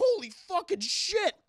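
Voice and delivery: slow, high, loud astonishment; acted shocked yell. Johnny looking down from mountain temple, acted shocked yell. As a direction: stretch out the shock, hammed up.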